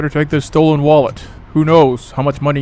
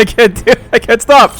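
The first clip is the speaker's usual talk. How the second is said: giggly and louder; can't stop laughing; 8 semitones higher, 6 dB louder than usual. Real, not acted. Laughing at himself.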